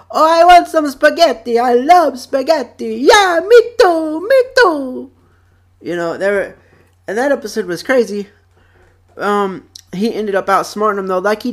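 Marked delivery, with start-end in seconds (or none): 0.1-5.1 high, loud, greedy cartoon crooks; imitated cartoon rats. Rat gang stealing noodles, hammed-up eager voices. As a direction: squeal it like excited cartoon thieves. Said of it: "and they were like".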